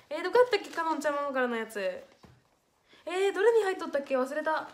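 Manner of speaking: loud fretting over a lost letter; flustered exclaiming. Real, not acted. Can't find the letter.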